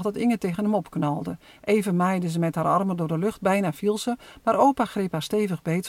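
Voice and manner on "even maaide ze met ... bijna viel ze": plain reading voice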